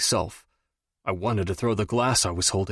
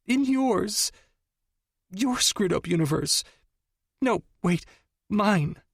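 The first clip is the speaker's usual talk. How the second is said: much higher, tearful and flustered; tearful lover; 8 semitones higher than usual. A narrator's reading. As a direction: sob out a muddled answer. Audrey tearful.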